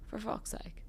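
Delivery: unvoiced, weary curse; whispered groan of disbelief; exasperated whisper. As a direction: sigh it out under the breath. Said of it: "And he just goes".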